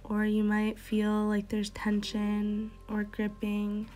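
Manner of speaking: soft, unhurried meditation guide voice; guided meditation. Acted. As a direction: lead them gently into calm, breath by breath. Yoga teacher leads a meditation.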